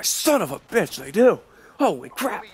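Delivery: high-pitched, drawn-out exclamation of disbelief; mock shock. One walks by right after his defense, mock shock. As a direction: act out being proven wrong on the spot.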